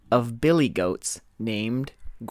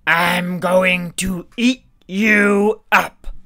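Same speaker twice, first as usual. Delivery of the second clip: much louder, higher troll bellow; troll shout; 7 semitones higher, 9 dB louder than usual. Acted. Troll shouting at the second goat, bellowing. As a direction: shout the threat like a monster. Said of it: "The troll shouted".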